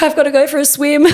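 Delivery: high line tipping into laughter; laughing. Real, not acted. Embarrassed girl excusing herself, laughing. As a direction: quote it while cracking up. Retold quote while laughing.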